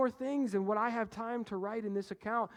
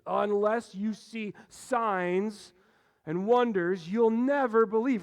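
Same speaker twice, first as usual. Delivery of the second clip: slow, louder, rising to a scolding "never"; accusing; 8 dB louder, slower than usual. Shown as a demonstration. Jesus rebukes the official for asking, in an accusing tone. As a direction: read it as a stern accusation. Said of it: "Jesus sounds like he's making an accusation".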